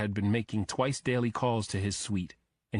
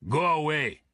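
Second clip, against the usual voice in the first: put-on loud, higher bark in a thick Russian accent; 6 semitones higher, 4 dB louder than usual. A narrator's reading. Bodyguard barks at knocking agents, thick Russian accent. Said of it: "barked in a thick accent".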